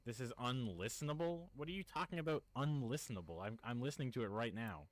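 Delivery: the same words as a very quiet playback from another speaker, far off; like a stream delay bouncing back in the background; faint distant echo. Real, not acted. Same words heard faintly again.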